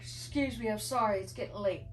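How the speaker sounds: talking through a yawn; sleepy yawn while speaking. Real, not acted. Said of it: "I'm yawning".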